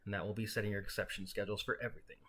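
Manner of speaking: normal talking voice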